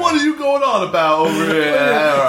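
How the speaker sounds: cheeky Mary Poppins Cockney; hammed-up British accent. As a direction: put on an exaggerated British street accent. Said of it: "moved on from Mary Poppins".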